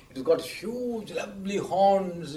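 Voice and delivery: slow, drawn-out admiration; acting out a smitten boy, slowly; mock rapture. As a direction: stretch out the adjectives in wonder. Boy adoring his buffalo.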